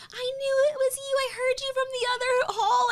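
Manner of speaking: high-pitched excited recognition, imitated; excited cashier. Cashier recognizing her in the store, high and excited. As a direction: imitate a thrilled stranger in a high voice. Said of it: "cashier people came up to us".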